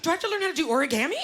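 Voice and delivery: high, fast mock-anxious question; flustered mother. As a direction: rush it out as a worried mom. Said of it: "She doesn't know what to do".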